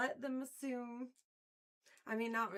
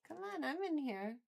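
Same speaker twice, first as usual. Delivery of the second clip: usual speaking voice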